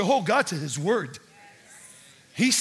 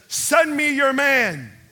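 loud, drawn-out battle challenge; booming taunt to an army; giant's challenge; 5 semitones higher, 7 dB louder, much slower than usual. Acted. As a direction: bellow it slowly across a battlefield. Acts Goliath's challenge.

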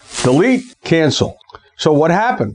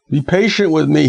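normal talking voice

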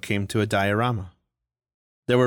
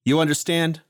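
higher defiant mock yell; screaming; 6 semitones higher than usual. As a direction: demand it of everyone. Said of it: "he screamed at everyone".

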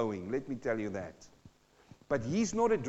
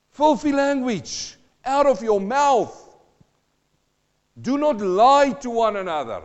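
preaching proclamation; 7 semitones higher, 10 dB louder than usual. Real, not acted. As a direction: thunder the verse at the church. Verse proclaimed at full force.

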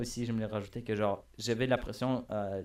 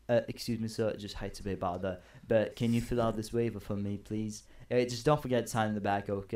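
polite, put-on British accent. Shown as a demonstration. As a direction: apologise in a mild British accent. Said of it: "je prends un accent britannique". (accent britannique poli, pris pour jouer)

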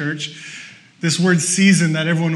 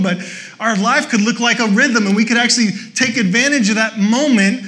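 loud, driving sermon delivery; preaching; 6 semitones higher, 7 dB louder than usual. Acted. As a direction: preach it with rising conviction. Preaching at full force.

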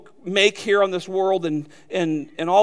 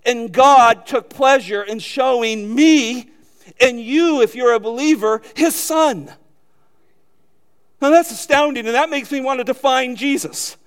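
high, loud, emphatic climax; fervent preaching; 6 semitones higher, 7 dB louder than usual. Real, not acted. Preacher astounded that God showed him his Son, fervently proclaiming. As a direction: raise the voice in amazement, leaning on each phrase.

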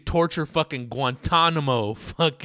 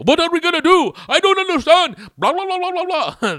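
much higher, loud whiny squeal; high mock whine; 8 dB louder than usual. Mocking arguing tourists in cars, high whiny squeal.

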